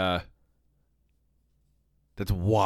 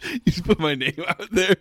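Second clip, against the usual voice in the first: laughing; 7 semitones higher, 3 dB louder than usual. Real, not acted. Laughing about name leak.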